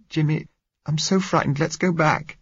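put-on high, anxious young woman; frightened girl voice. A narrator's reading. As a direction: voice a scared girl pleading.

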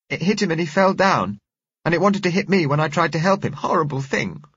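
put-on high, loud, indignant child; upset little girl. A narrator's reading. Girl tells how the thing attacked, upset and high. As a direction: pour it out in a distressed girl's high voice.

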